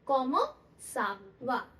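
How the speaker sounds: कॉमो सावा said slowly, each syllable clear; कॉमो सावा drawn out as komo sava; slow French demo. Shown as a demonstration. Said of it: "how are you को क्या बोलते हैं?".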